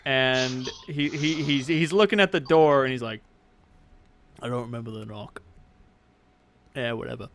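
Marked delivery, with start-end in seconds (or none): 4.4-7.3 low, soft ogre voice; dismissive, lowered character voice; ogre grumbling to himself. Acted. Ogre forgets the secret knock.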